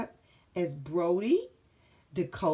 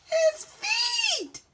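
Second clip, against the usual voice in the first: high loud alarmed yell; 6 dB louder than usual. Acted. Dakota yelling at the soup in alarm, high and loud. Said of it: "yelled Dakota".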